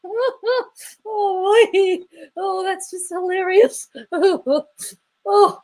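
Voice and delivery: very high, laugh-squeezed voice; laughing to tears. Real, not acted. Streamer finding a comment hilarious, tearful laughter. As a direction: barely get the words out after a real laughing fit.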